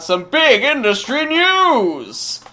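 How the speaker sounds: big, drawn-out segment announcement; hyped announcer. Pretend. Host announcing the news segment, in hyped announcer style. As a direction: boom it out, stretching the last word.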